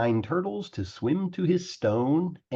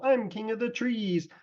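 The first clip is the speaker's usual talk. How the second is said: much higher, giddy boastful king; gloating turtle king; 7 semitones higher than usual. A narrator's reading. Yertle boasting forty miles up, giddy and proud. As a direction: crow about ruling the very air.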